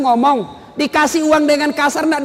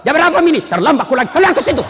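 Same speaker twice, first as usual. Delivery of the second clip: rude scolding; 3 semitones higher, much faster than usual. Retold or imitated. Lecturer snapping at a latecomer, mock angry scold. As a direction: snap at the late student, half-acted. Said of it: "Coba kalau".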